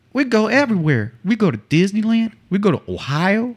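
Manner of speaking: high, put-on kid voice making things up on the spot; high mock-innocent child voice rattling off places; Sport making it up. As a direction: pitch it up like a girl inventing trips. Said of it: "this is what Sport pulled out of her ass".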